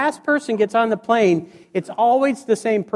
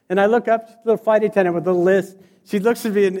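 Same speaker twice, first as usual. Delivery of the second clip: normal talking voice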